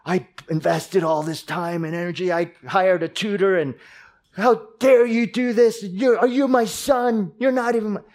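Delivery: building from indignant to a high, loud yell; mock raging parent. Preacher imitating a parent screaming at a son over a B grade, furious. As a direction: play a furious parent losing it over one B.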